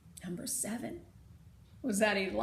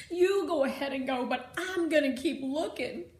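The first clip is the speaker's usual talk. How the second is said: louder, stubborn insistence; defiant; 4 semitones higher, 7 dB louder than usual. Acted. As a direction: refuse to budge. Girl refuses to leave.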